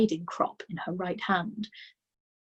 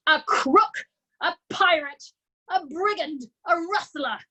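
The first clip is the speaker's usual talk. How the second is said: much higher screamed list of insults; screaming accusations; 9 semitones higher, 4 dB louder than usual. A narrator's reading. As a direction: shriek each word higher. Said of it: "the Trunchbull screamed".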